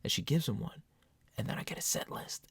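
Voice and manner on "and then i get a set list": hushed, unvoiced whisper. Real, not acted. To listener, recalling the set list, whispered. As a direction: breathe it out in awe.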